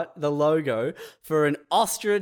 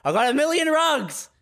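mock pitchman; 11 semitones higher than usual. Warehouse guy proudly naming his rug business, high. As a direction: announce it like a cheap ad.

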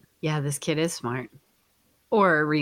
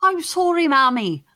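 put-on high, loud Irish child; mock Irish accent; 10 semitones higher, 5 dB louder than usual. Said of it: "You do an Irish accent then".

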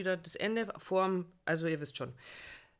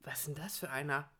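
whispering; 4 semitones lower, 7 dB quieter than usual. Retold or imitated. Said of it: "hat der zu mir gesagt".

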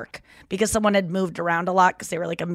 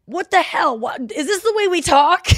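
mock disbelief; 9 semitones higher, 4 dB louder than usual. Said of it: "then he goes".

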